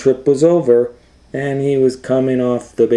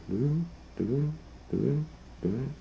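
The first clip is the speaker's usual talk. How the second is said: high, soft rotary-dial clicks; soft dial noise; 6 semitones higher, 15 dB quieter, faster than usual. Shown as a demonstration. Showing the child how old phones dialed, soft clicks. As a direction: tick out the dial turning quietly. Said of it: "You had to dial it like this".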